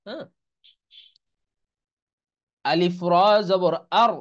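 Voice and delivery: ordinary talk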